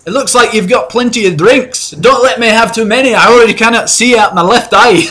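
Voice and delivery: put-on loud, high, boisterous drunk dwarf; drunk dwarf mercenary. Dwarf mercenary praising the heroes, loud drunken voice. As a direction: bellow like a tipsy burly dwarf. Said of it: "he goes".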